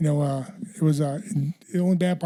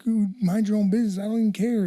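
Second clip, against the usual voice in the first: higher-pitched mock protest; retold dismissive retort; 6 semitones higher than usual. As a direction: lightly act out brushing someone off. Retold reply to nosy people.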